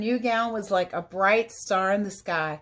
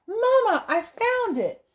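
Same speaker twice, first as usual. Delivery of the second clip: excited shout; 12 semitones higher, slower than usual. A narrator's reading. Girl telling her mother she found the gown, excited shout. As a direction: cry out the good news. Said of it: "Irene shouted".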